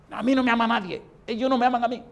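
imitación del enfermo protestando, voz alta y fuerte; réplica amarga y gritada del viejo; protesta airada. Retold or imitated. Enfermo airado responde al pastor. (protesta enojada, imitada)

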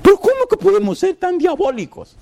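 very high, wailing lament; crying lament. Retold or imitated. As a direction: cry it out to mother in despair. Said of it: "él lloraba".

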